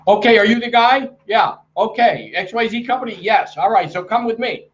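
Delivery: high-pitched, rapid-fire imitation of a businessman; hammed-up high, hurried back-and-forth; brisk little brother. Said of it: "but he was like this".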